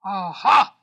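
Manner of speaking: booming, triumphant "Aha"; lion catching his prey, loud. A narrator's reading. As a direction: pounce on it like a lion. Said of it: "said the lion".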